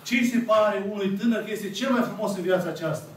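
ordinary delivery

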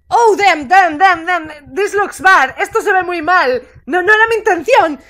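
loud, agitated exclamations; alarmed outburst. Real, not acted. Player reacts to the chase going badly, loud alarm.

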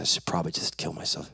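low, soft, hurried confession; mumbled quietly, as if owning up; sheepish admission. Retold or imitated. Son repeats his words to dad, quiet sheepish admission.

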